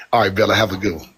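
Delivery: ordinary delivery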